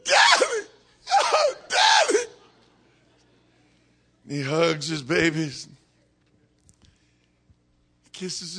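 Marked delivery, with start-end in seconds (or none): 0.0-2.2 very high, loud mock child cry; squealing kids. Preacher acting out kids crying to their daddy, squealing. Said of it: "And the kids".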